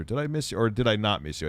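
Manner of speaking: ordinary delivery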